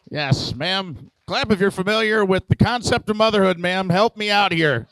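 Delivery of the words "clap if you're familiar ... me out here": loud, higher, pushing the room; urging the crowd. Real, not acted. Comic begs the audience to clap, loud and pleading. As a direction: demand applause from the audience. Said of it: "urging crowd to clap".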